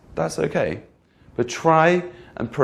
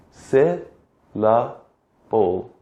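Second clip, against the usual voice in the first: "Se, la, bo" as three separate beats, stepping down in pitch; slow, split syllables; much slower than usual. Shown as a demonstration. Teacher showing the chin moved three times, slow syllable-by-syllable demo. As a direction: pause after each of "Se", "la", "bo". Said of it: "Say it slowly and pause for each syllable".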